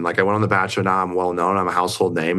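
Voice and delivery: ordinary delivery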